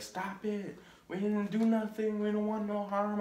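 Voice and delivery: retold frantic plea to back off; girlfriend pleading. Girlfriend stepping between the drunk and her boyfriend, pleading. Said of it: "screaming at him".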